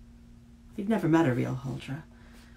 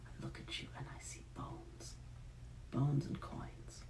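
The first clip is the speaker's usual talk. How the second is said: much softer breathy whisper, venom in it; hissed whisper; 12 dB quieter than usual. A narrator's reading. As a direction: spit it out in a hushed whisper. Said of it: "she hissed".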